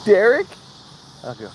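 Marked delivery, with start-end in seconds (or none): 0.0-0.5 high, bright-eyed "Derek?"; sudden hopeful recognition; surprised question. Retold or imitated. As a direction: call the name with eager recognition. Cashier mistakes him for Derek.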